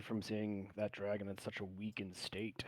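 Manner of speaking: breathy whispered aside. Acted. The hero whispers to Sir Lucas about the dragon, whispered. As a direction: keep it secret, all breath.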